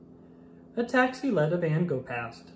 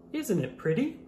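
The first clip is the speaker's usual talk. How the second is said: usual speaking voice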